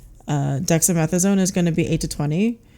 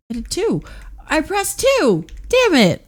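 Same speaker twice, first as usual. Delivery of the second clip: frustrated squeal; 11 semitones higher than usual. Real, not acted. Frustrated at misclick in quiz.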